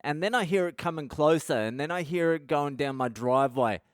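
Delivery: usual speaking voice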